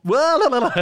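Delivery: high, loud burst of glee; excited shout. Real, not acted. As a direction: blurt it out triumphantly. Proud end of rhythm explanation.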